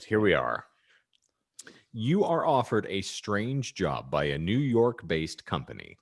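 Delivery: ordinary talk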